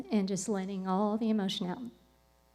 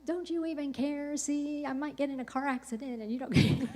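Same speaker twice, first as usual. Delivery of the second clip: high, laughing mock-whine at God; laughing complaint; 6 semitones higher than usual. Retelling her tearful drive, she laughs while whining at God. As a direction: complain through laughter, half joking. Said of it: "I'm just crying so hard".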